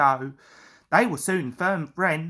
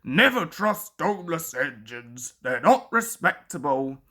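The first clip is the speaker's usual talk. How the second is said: Gordon's voice; 6 semitones lower than usual. A narrator's reading. Gordon continues.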